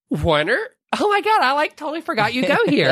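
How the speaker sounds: put-on high, gushing valley girl voice; bubbly Elle. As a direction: play her high and bubbly. Elle voiced meeting Warner.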